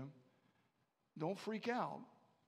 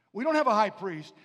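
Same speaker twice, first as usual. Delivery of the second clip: emphatic proclamation; 3 semitones higher, 16 dB louder than usual. Acted. Preacher repeats scripture emphatically.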